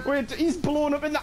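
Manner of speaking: ordinary talk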